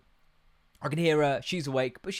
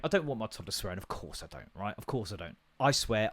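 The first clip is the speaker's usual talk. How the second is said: ordinary talk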